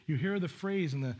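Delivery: ordinary talk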